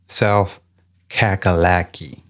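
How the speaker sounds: slow, drawn-out Southern South Kakalaki; local Southern pronunciation. Acted. Host corrects co-host on saying South Carolina, local Southern drawl. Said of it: "you gotta say it right".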